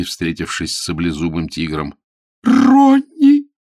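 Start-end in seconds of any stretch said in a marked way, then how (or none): 2.4-3.5 piercing high scream; primal scream. A narrator's reading. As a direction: scream like facing a sabre-toothed tiger. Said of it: "Так кричали её пещерные бабки".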